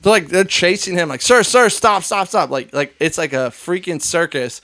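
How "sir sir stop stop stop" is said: calling out. Retold or imitated. Staff chasing man on scooter.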